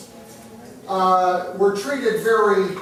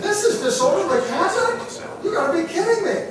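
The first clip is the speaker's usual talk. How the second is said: much higher, laughing as you ask; squeaky incredulous laugh-talk, quicker on the last line; laughing disbelief; 12 semitones higher than usual. Retold or imitated. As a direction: scoff at the absurd charge through laughter. Incredulous at being arrested.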